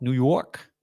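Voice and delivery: Scot's put-on New York accent. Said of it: "or a New York?".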